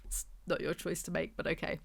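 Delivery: flat, quiet deadpan put-down; dry sarcastic aside. Real, not acted. Reviewer deadpanning at the book's line. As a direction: mutter it dryly, unimpressed.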